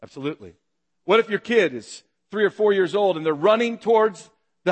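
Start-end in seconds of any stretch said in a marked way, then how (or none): none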